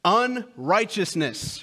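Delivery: ordinary delivery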